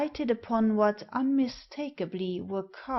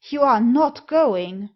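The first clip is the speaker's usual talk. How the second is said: loud, shocked cry; loud protest at the departing men; cried out; 3 semitones higher, 9 dB louder than usual. A narrator's reading. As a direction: shout it in alarm to stop them. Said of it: "I cried".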